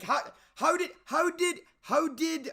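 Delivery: can't believe the movie did this; disbelief. Real, not acted. Reviewer ranting about the brother being saved, baffled.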